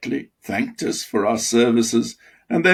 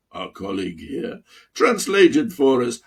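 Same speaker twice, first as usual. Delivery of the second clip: plain reading voice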